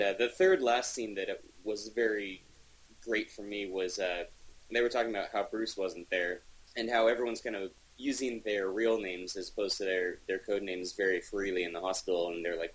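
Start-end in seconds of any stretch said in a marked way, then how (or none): none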